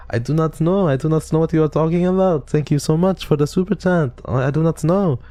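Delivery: high-pitched fake French accent; Zali's mock French accent. Streamer mocking Zali thanking for superchats, high French accent. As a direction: put on a careful, squeaky French accent. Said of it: "I can't copy his accents".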